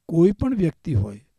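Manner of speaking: usual speaking voice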